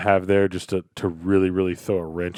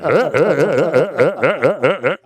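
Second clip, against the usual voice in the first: mock Seth Rogen laugh; 4 semitones higher, 5 dB louder than usual. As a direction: cackle like a high buddy. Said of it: "Seth would be like".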